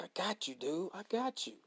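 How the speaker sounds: hushed, soft I got you; soft reassurance. Retold or imitated. God quietly reassuring the worried speaker, hushed. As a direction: whisper it like a calming voice.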